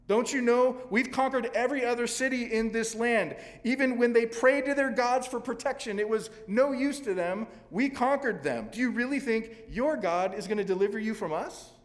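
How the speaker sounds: high, loud taunting shout; mock the besieged city loudly; enemy commander jeers from below the wall; commander yelling. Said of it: "he was yelling at them".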